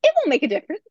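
mock impression. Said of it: "continuing the impression".

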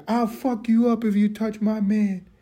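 high, shrill-free threat, mock female voice; hammed-up girlfriend threatening to fight; mock angry woman. Teller mimics battered woman threatening rescuers, mock high angry voice. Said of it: "She's like".

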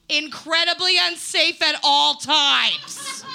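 high, loud, stretched-out blurt; big drawn-out mock proclamation; loud punchline. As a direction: belt it out slowly, every word big. Punchline twist on song quote.

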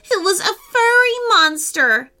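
put-on high, loud tearful child's outburst; upset blurting. A narrator's reading. Emily accuses the monster, blurting it out in distress. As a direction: blurt it out like a distressed little girl. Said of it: "blurted out Emily".

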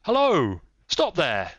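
high, loud challenge; shouted. A narrator's reading. Cyclist blocking the road to the dog-cart, shouting. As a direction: shout to halt a carriage. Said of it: "he shouted".

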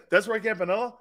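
retold disbelief with rising end; incredulous question. Grandfather seeing the player at the ballpark, incredulous question. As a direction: ask it doubtfully, laughter creeping in. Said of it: "he goes".

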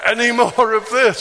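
high, loud words bubbling with laughter; laughing. Real, not acted. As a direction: laugh through the retelling. Laughing retelling Shelley's words.

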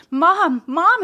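high, urgent call for mom; boy calls for his mother, high and urgent; shouted. A narrator's reading. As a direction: call out while running up the stairs. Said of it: "he shouted".

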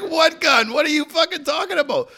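high, loud mock disbelief; baffled protest. Half-drunk man answering armed cops, mock baffled protest. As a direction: imitate a bewildered man protesting loudly. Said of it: "He's like".

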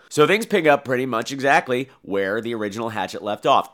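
normal talking voice

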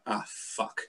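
unvoiced, soft under-breath curse; muttered curse. [name] arriving backstage, cursing softly. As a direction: whisper the curse in dismay. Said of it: "turned up going".

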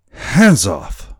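put-on high, loud drunken warning cry; sudden loud yell from a drunken sailor; drunken shout. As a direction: shout it out to fend someone off. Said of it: "cried the boatswain".

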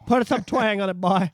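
hammed-up country twang; country-boy twang, played for laughs; mock country twang. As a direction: urge it in a put-on country accent. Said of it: "put a little bit of twang".

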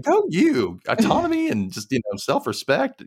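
high, bright cheer for her; cheering a character for her self-respect, pitched high; mock cheering. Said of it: "I'm like".

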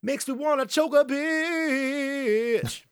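very high, drawn-out mock rage; hammed-up high-pitched exasperation; mock outburst. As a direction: stretch the last word into a long high held note.

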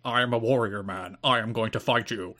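put-on deep, solemn warrior voice; mock-serious deep wrestler voice; Loki. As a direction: intone it low and stiff like a wrestling promo. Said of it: "in my deep Loki voice".

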